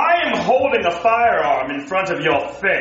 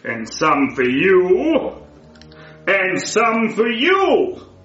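slow sinister dealing; 4 semitones lower, much slower than usual. Acted. Villain handing out poison.

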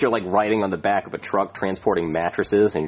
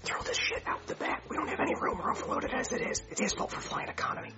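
soft whisper behind the passenger's back; whispered aside; 13 dB quieter than usual. Acted. Pilot secretly orders the luggage dumped, soft whisper. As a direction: hiss it out of earshot.

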